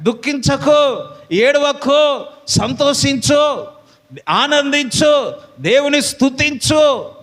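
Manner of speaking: uplifting slow call to rejoice; slow preaching exhortation. Acted. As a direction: preach it out word by word. God speaking to congregation.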